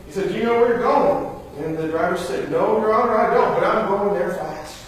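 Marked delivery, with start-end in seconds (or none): 0.3-1.4 a higher, louder call to the driver; retold shout. Huxley calling up to the driver, mock shout. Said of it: "he shouted to him". 2.5-4.8 cab driver. Retold or imitated. Cab driver answering cheerfully.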